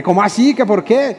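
mock yell.

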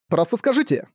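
высоко, с нетерпением; потребуй ответа, резко подняв тон; нетерпеливое требование. A narrator's reading. (нетерпеливо)

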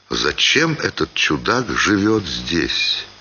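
ordinary delivery, nothing marked

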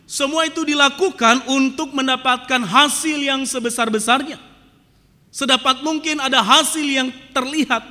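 loud, driving sermon delivery; forceful preaching. Acted. Pastor hammering his point to the congregation, loud. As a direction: preach it out to the whole church.

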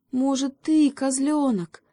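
put-on high, hopeful hare asking the kid goat. A narrator's reading. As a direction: still hoping, voice up high. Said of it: "всё ещё надеялся заяц". (с надеждой, высоким голосом)

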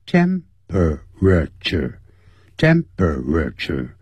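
"temperature" said slowly with all four syllables, tem-pe-ra-ture. Shown as a demonstration. Teacher showing the full four-syllable "temperature". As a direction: spell "temperature" out in four slow beats. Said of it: "本来是四个音节".

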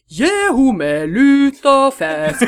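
loud, slow, put-on northern accent mockery; mock Oslo guy yelling. Stranger mocking her northern dialect, loud put-on voice. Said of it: "Og så står en fyr ved siden sånn".

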